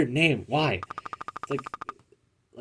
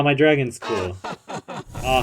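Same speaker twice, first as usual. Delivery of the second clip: ordinary talk, nothing marked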